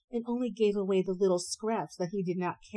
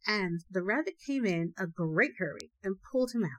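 plain reading voice

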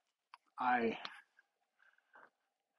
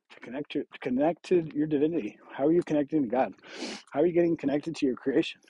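normal talking voice

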